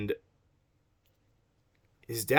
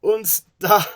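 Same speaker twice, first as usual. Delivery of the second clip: high, loud mock German; a comic German voice, hammed up; exaggerated German accent; 10 semitones higher, 11 dB louder than usual. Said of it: "How's a German talk?".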